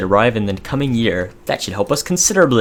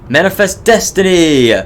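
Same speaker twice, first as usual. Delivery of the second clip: triumphant cry; 9 semitones higher, 7 dB louder than usual. Acted. Exultant cry over game victory.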